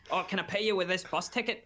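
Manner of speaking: high-pitched mocking imitation of a joker; mimicked customer. Customer jokes about paying the barman, mimicked. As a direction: put on a high, jokey punter voice.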